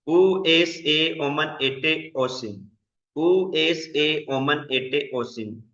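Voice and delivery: each Greek ending spaced out, slow and clear; slow drill recitation. Shown as a demonstration. Teacher drilling Greek verb endings for the class, slow and deliberate. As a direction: recite the endings slowly for students to repeat. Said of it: "ये वाली आपने ending join याद करनी है".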